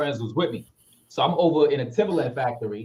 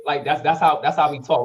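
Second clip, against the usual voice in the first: ordinary talk, nothing marked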